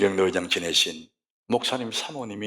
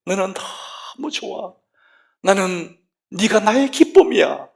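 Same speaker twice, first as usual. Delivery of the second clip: tender voice of God; 9 semitones higher, 5 dB louder, slower than usual. Acted. God's loving words to his son.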